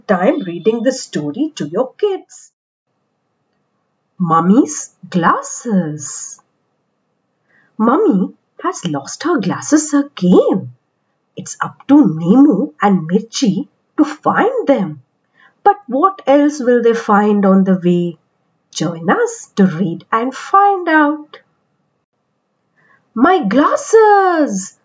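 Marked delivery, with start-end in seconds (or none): 4.2-6.4 slow, drawn-out title announcement; title read slowly. A narrator's reading. Storyteller announcing the title slowly to children. 23.1-24.8 higher, exclaiming mother's cry; Mummy calling out. Acted. Mummy calling out to the house for her glasses.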